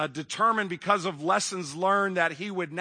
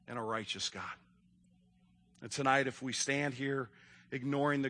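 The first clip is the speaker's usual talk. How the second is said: solemn preaching; 4 semitones lower, 4 dB quieter than usual. Acted.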